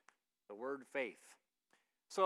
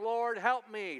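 mock scream, raised and higher; imitated scream; 6 semitones higher, 6 dB louder, slower than usual. Woman coming in to Jesus, mildly imitated screaming. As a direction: cry out loudly, stopping short of a real scream. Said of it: "she's coming in and she's screaming".

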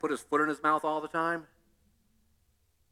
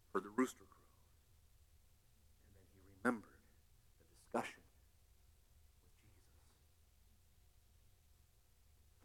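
hushed narration; 11 semitones lower, 27 dB quieter, much faster than usual. A narrator's reading.

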